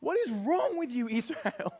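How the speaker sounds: high, incredulous rebuke; the reader rebuking grumbling Israel in disbelief; exasperated cry. Retold or imitated. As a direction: throw up your hands at Israel. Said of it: "stand back and go".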